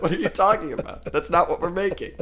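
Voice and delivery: high, laughing retort; amused, baffled quote with laughter; laughing while quoting. Retold or imitated. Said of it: "It's like".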